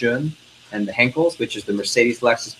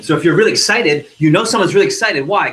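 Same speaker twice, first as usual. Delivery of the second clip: excited; 5 semitones higher, 6 dB louder than usual. Shown as a demonstration. Sales trainer demonstrating an excited voice to the class, bright and loud. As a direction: demo of an excited voice, louder and higher. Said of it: "Like that".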